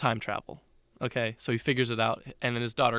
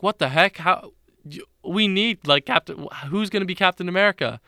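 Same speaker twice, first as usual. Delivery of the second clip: higher and louder, quick, imitating shocked friends; retold disbelief; 7 semitones higher, 6 dB louder than usual. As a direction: act out their stunned what-the-heck. Retold shocked reaction to the shield.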